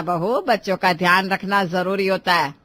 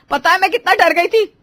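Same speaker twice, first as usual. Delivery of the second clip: high, loud worked-up reproach; upset scolding; 7 semitones higher, 6 dB louder than usual. Acted. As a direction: scold out of fright. Mother scolds children after a scare. (घबराई माँ की ऊँची डाँट)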